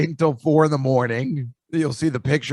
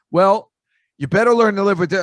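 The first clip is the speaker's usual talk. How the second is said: defiant retort; 7 semitones higher, 7 dB louder than usual. Retold or imitated. Defiant retort to angry friend.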